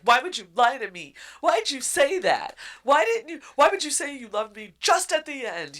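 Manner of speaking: high, loud anguished questions; distraught shouting. Acted. Grieving queen yelling at her dead love, distraught. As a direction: shout it, losing control. Is freaking out.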